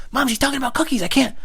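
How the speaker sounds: very high, whiny protest; exasperated child. Retold or imitated. Child explains to mom mid-call, exasperated high whine. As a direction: plead back in a squeezed high voice.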